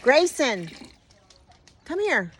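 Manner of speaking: high loud call across the yard; calling the dog. Real, not acted. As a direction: call him over from a distance.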